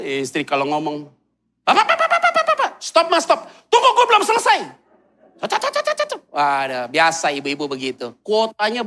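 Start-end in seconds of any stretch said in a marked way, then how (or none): 1.7-4.6 high, loud rapid interrupting wife; nagging wife. Retold or imitated. Wife stopping her husband mid-sentence, high rapid mock nagging. 5.4-6.2 high, quick yes-yes chatter; mock agreeing husband. Husband agreeing with the wife, high quick mock assent.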